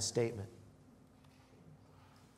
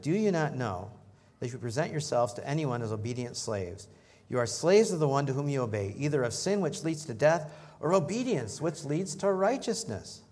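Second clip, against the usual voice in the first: louder, higher preaching read; scripture reading; 3 semitones higher, 5 dB louder than usual. Acted. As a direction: proclaim the verse to the congregation. Reads scripture aloud.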